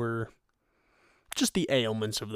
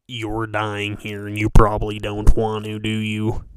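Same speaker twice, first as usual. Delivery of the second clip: taunting; 9 dB louder than usual. Retold or imitated. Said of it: "turn around and say".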